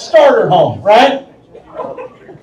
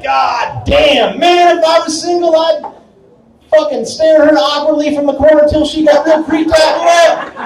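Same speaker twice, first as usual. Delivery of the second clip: excited bar bravado; 8 semitones higher than usual. Retold or imitated. Guy shouting to his buddies at the bar, in high excited bravado. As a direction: whoop it up like a lech in a bar. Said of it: "I'll be like".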